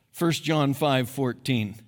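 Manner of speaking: ordinary talk, nothing marked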